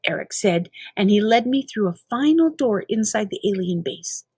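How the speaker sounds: ordinary delivery, nothing marked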